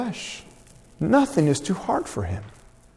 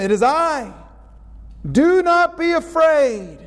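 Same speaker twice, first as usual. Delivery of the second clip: high, slow, reassuring voice of Jesus; Jesus comforting; 10 semitones higher, slower than usual. Acted. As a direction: lift the voice and stretch it out to calm them. Jesus calming frightened disciples.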